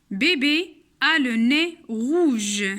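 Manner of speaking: very slow "bébé a le nez rouge." with "nez" said as né; slow dictation reading. Shown as a demonstration. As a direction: read "bébé a le nez rouge." word by word for learners. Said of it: "On a ici E accent".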